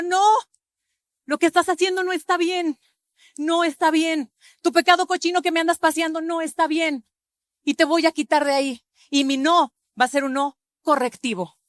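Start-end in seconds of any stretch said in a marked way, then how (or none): 0.0-8.9 higher, louder stern rebuke; shouting spirit. Pretend. The spirit shouting at the stubborn sinner, stern rebuke. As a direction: cry out a warning that stops a sinner. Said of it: "nos va a seguir gritando".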